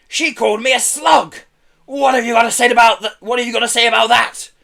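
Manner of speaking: high, loud indignation; shrieking. A narrator's reading. Pelly insulted, rounding on Herb, shrieking. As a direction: demand an answer at a shriek. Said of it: "shrieked Pelly".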